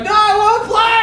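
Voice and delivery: put-on very high, loud childish protest; mock kid's tantrum. Boy arguing against bedtime, mock high shrieking. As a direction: imitate a boy shrieking back at dad.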